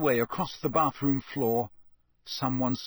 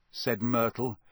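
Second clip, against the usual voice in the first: ordinary delivery, nothing marked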